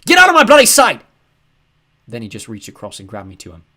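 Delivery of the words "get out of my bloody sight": furious yell, much higher and louder. A narrator's reading. Father yelling at his son in the kitchen. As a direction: snap it at him. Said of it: "he yelled".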